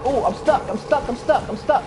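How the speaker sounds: high panicked repeats; stuck and panicking. Real, not acted. Trapped in game.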